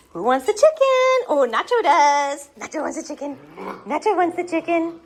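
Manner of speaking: high, loud eager teasing; excited puppy talk. Real, not acted. Owner hyping Nacho over the chicken toy, high and loud. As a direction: hype up the puppy.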